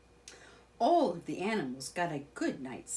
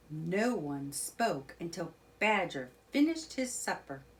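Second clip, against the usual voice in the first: usual speaking voice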